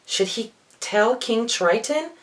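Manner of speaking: ordinary delivery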